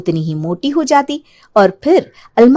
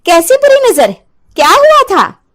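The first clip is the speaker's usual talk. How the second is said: puzzled Ranjana; 10 semitones higher, 4 dB louder than usual. Acted. Ranjana asks.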